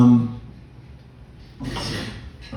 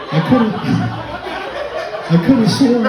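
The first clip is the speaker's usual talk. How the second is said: high, mock-innocent protest; innocent denial; 11 semitones higher than usual. Drunk friend to police officer, higher mock innocence. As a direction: play the wide-eyed drunk excuse, higher. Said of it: "She was like".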